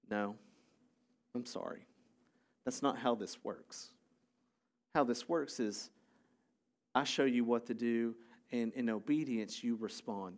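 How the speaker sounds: low, quiet, measured correction; calm refusal. Acted. Jesus answering those who want a show, calm and quiet. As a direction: gently turn them down, low and soft.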